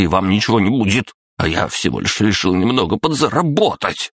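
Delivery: snarling rage. A narrator's reading. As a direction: spit insults with bared teeth. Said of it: "вдруг злобно оскалился".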